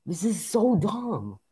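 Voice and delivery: loud, high outburst of frustration; real gamer rage. Real, not acted. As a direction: rage at the game, pitch shooting up. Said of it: "I'm raging".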